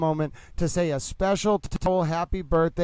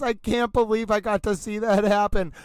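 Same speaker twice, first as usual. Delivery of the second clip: ordinary talk, nothing marked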